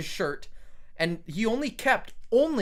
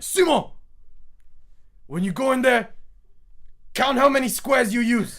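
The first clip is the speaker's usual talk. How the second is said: loud call down the hallway, higher and louder; 8 semitones higher, 7 dB louder than usual. Retold or imitated. Grandpa yelling an order to grandson in the bathroom, loud call. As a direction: shout it down the hall at the grandson.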